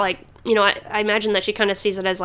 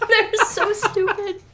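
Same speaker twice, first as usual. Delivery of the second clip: high, slow words broken by giggles; laughing; 12 semitones higher, slower than usual. Real, not acted. Host cracking up at the dumb rubies, high giggly voice. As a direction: laugh through the words at how dumb they are.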